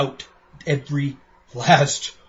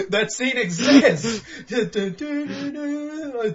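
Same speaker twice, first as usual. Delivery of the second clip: laughing; 11 semitones higher, 4 dB louder, much slower than usual. Real, not acted. Laughing, humming dramatic music.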